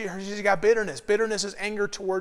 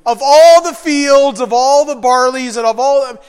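much louder, slow, grand proclamation; dramatic declaration; 4 semitones higher, 13 dB louder than usual. Acted. Preacher parodying the old movie line, booming. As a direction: boom it like a movie hero.

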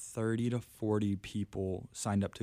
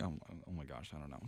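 mumbled reply; 6 semitones lower, 15 dB quieter, faster than usual. Retold or imitated. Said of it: "And I'm like".

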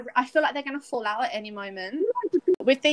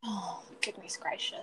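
whispered; 14 dB quieter than usual. Real, not acted. Reacting to sparkling water love.

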